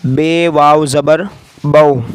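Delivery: teacher spelling a syllable; slow spelling out. Shown as a demonstration. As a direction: spell बे वाव ज़बर slowly, land on बो. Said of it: "इज्जम किस तरह करेंगे".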